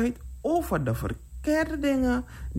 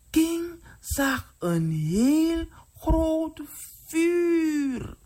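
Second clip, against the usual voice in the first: high, slow, drawn-out suspense; dramatic storytelling; 9 semitones higher, much slower than usual. A narrator's reading. Storyteller shows children the huge fire, drawn-out and dramatic. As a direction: stretch the words out high in dread.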